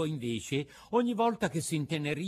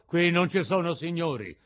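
gruff puppet master; 6 semitones higher, 8 dB louder than usual. A narrator's reading. Mangiafoco replies.

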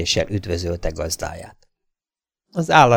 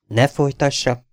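cold, higher-pitched cut-in; cold interruption; 7 semitones higher, 3 dB louder than usual. A narrator's reading. Girl stops an unwanted suitor, cold interruption. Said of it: "vágott közbe a lány hidegen".

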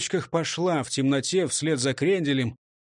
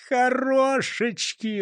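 smiling; 8 semitones higher, much slower than usual. A narrator's reading. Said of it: "улыбнулся Густав Гейер".

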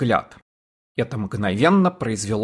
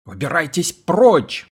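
slow high yell; 6 semitones higher than usual. A narrator's reading. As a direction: bellow them out. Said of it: "завопил".

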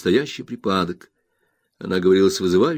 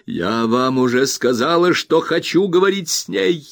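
high and loud furious insistence; cry out; 8 semitones higher, 5 dB louder than usual. A narrator's reading. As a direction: shout him down. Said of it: "вскричала она". (Роза кричит, что будет говорить)

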